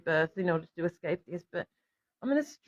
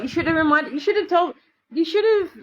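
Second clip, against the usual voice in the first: each repeat pitched higher, building; rising complaint; 7 semitones higher than usual. Real, not acted. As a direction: pile up the blame, climbing higher each time.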